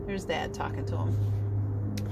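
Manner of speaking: hushed aside. Real, not acted.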